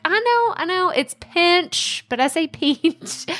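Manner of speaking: "pinch" said the Texas way, high and bright; a playful high defence of "pinch"; East Texas pinch. Retold or imitated. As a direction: protest high-pitched, owning her "pinch". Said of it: "And I'm like".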